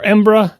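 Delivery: Embra in two syllables; swallowed pronunciation. Shown as a demonstration. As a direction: reduce Edinburgh to "Em-bruh". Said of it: "if you're really in a hurry".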